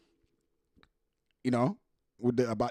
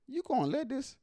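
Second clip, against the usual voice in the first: voicing him in a much higher, softer squeak; high mock voice; 10 semitones higher, 7 dB quieter than usual. Reacting to the video, a high soft squeaky mock voice. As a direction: squeak it out like the stunned guy. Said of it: "He's like".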